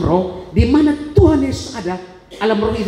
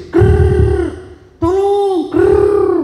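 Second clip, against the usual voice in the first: gurgle "Krrr", then a high strained "tolong"; gurgling cry; 10 semitones higher, 4 dB louder, much slower than usual. Retold or imitated. Man showing the gurgle from his cut throat, higher and louder. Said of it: "Suara keluar dari sini".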